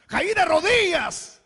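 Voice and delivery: high, loud, slow cry of awe; falling to his knees in wonder, high and loud; Thomas in awe. Acted. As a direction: cry it out like a man overwhelmed. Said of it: "podría decir Tomás".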